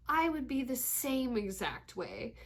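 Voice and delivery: ordinary talk, nothing marked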